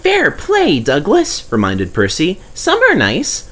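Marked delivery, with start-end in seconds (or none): 0.0-1.4 high, eager little engine voice; Percy's voice. Acted. Percy reminding Douglas, in a high eager voice. 2.5-3.4 high, eager little engine voice; Percy's voice. Acted. Percy reminding Douglas, in a high eager voice.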